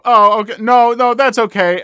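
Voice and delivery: lightly voiced nervous backing off; a touch of a caught-out parent; backpedaling mother. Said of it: "she got to go".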